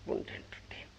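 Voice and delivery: whispered echo; whispering. A narrator's reading. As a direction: breathe the words, no voice. Repeated, whispered.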